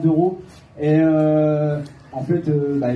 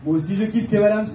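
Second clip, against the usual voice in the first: normal talking voice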